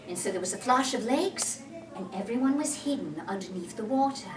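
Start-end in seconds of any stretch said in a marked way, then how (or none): none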